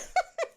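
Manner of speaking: very high giggle; squealing laugh. Real, not acted. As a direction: dissolve into a squeal.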